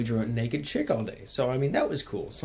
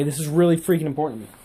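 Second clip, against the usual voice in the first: REALLY FREAKING pushed higher and louder, quick; emphatic insistence; 5 semitones higher, 7 dB louder, faster than usual. Real, not acted. Vlogger insisting to viewers the book matters, emphatic insistence. Insisting, repeats with more force.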